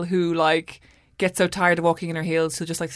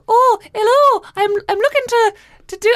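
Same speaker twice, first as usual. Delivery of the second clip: a hammed-up Scottish old man voice, pitched way up; bad Scottish accent; 7 dB louder than usual. Retelling the prank caller's voice, a hammed-up Scottish accent. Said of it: "I can't do a Scottish accent".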